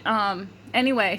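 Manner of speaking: usual speaking voice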